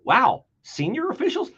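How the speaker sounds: high, rising mock astonishment on "officials"; mock surprise. Shown as a demonstration. Host showing viewers how to react, in mock surprise. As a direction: feign shock at the words. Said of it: "you could pause over and go".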